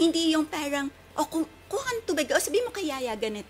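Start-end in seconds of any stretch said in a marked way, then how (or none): 1.1-3.5 hurried bossy parent. Retold or imitated. Parent rushing orders at the child instead of teaching, fast and bossy. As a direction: rattle off orders fast and high.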